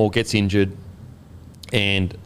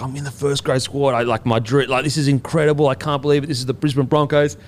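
retold excitement, higher and rushing on; stoked; 4 semitones higher than usual. Player calling his missus about making first grade, stoked. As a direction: gush like you're sharing big news. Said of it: "So I'm sitting there stoked".

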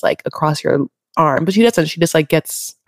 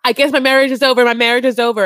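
mock dramatic wife; 6 semitones higher than usual. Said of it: "She's like".

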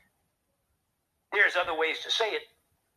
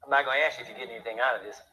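quick low aside; 6 semitones lower, much faster than usual. Real, not acted.

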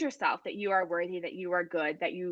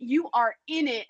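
usual speaking voice

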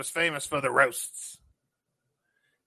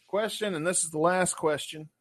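usual speaking voice

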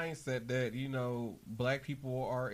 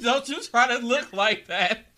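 laughing tease; 9 semitones higher, 12 dB louder than usual. Real, not acted. Teasing friend, laughing.

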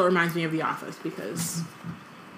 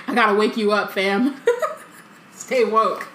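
slangy Moody; 3 dB louder than usual. Retold or imitated. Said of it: "it sounds so like".

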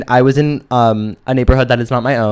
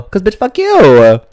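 defiant kiss-off; 7 semitones higher, 6 dB louder, slower than usual. Retold or imitated. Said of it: "And I'm like".

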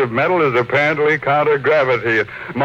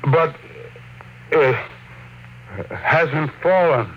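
slow, halting, dazed; stunned disbelief; 4 semitones higher, 3 dB quieter, slower than usual. Acted. Tycoon watching the metal hang in midair, dazed awe. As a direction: let each word drop out in shock.

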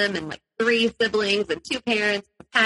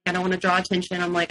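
normal talking voice

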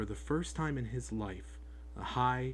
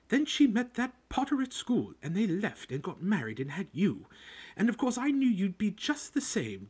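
high, loud, jealous ranting about her sister; spiteful rant; 10 semitones higher, 5 dB louder than usual. Acted. Aunt venting to Harry about her sister, loud spiteful rant. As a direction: spit out years of resentment. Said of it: "went ranting on".

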